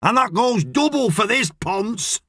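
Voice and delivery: high, drawn-out irritated shout. A narrator's reading. Boss yelling again across the unit about the radio, shouting. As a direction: yell the order out slowly. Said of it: "Hooters shouts".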